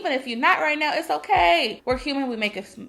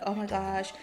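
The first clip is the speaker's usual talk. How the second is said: timid murmur; 3 semitones lower, 9 dB quieter than usual. Shown as a demonstration. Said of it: "like, whispering".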